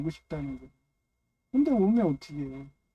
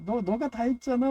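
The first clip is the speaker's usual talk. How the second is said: higher, coaxing praise; Nami praising Chopper; 6 semitones higher than usual. Retold or imitated. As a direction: flatter him, pitched up. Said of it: "막 이러면은".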